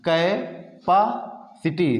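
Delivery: slow teaching chant; very slow drawn-out word. Shown as a demonstration. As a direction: stretch capacity syllable by syllable. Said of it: "learn करने का तरीका बता रहे हैं".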